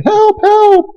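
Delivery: high panicked cry for help; frantic girls' cry. Retold or imitated. Preacher voicing scared girls by the creek, high and panicked. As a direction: shriek it like a scared girl.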